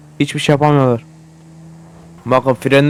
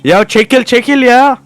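higher, louder shout to get out of the way; yelling; 8 semitones higher, 3 dB louder, slower than usual. Real, not acted. Player yells at a car blocking him, loud. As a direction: bark at the car blocking the road. Yelling at traffic while driving.

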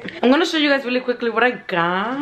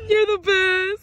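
choked-up thanks; 7 semitones higher, slower than usual. Real, not acted. Friend opening a gift, overcome and crying. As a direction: blurt it out through real tears. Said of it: "She gonna cry".

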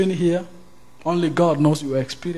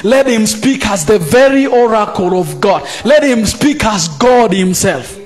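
loud, high, impassioned sermon delivery; fiery sermon voice; fiery preaching; 8 semitones higher, 5 dB louder than usual. Acted. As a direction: preach it with full force to the congregation. Preaching at full force.

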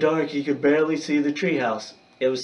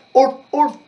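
high, loud imitated dog bark; 13 semitones higher, 9 dB louder than usual. A narrator's reading. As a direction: bark like a little dog, much higher and louder. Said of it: "The barking came from a distance".

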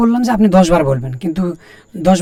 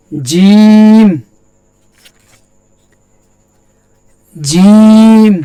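জিম called out high and loud, drawn out; clear recitation; 3 semitones higher, 7 dB louder, much slower than usual. Shown as a demonstration. Teacher reciting জিম loudly for students to repeat. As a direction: project জিম like a Quran recitation. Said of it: "দেখেন আমার উচ্চারণের সাথে আপনার উচ্চারণ".

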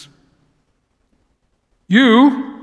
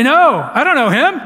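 acted denial, voice pitched up; insistent denial; 6 semitones higher, 3 dB louder than usual. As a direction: deny it as if cornered by questioners. Acting out Peter's denial.

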